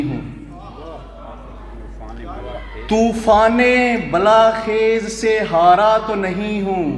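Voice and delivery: ghazal recital. Acted. Poet presenting his ghazal couplet to the mushaira, slow declamation. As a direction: lift the opening of each line and stretch it out.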